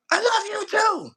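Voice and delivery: high, loud I love you too; mock loud reply. Shown as a demonstration. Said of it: "so much passion and, and calmness".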